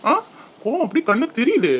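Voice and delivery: ordinary talk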